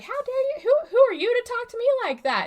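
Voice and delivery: high, loud mock outrage; hammed-up indignant squeal at the marshal; high and loud, fast in the second line; outraged retort. Acted outraged drunk woman.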